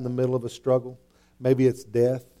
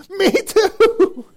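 much higher and louder, laughing; excited agreement; 6 dB louder than usual. Acted. Preacher playing a parent who also yelled at his kids, laughing. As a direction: blurt it out delighted. Mock reply, church drive yelling.

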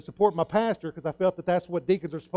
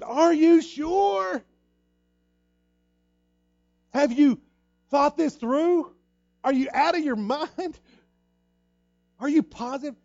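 high, loud, slow doubt; hammering the same worried question; loud, high disbelief; incredulous questioning; 12 semitones higher, 8 dB louder, slower than usual. Retold or imitated. Pastor questioning three times.

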